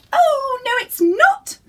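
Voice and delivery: very high, loud squeaking tiny woman; tiny wife squeaks a threat; squeaky voice. Acted. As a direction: squeak like a pepper-pot-sized wife. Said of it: "squeaked the little voice".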